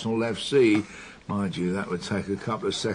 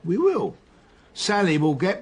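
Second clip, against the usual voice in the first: emphatic; 8 semitones higher, 5 dB louder than usual. Acted. Detective insists confidently.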